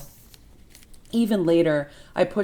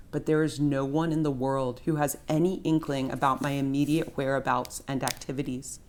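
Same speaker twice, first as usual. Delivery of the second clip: usual speaking voice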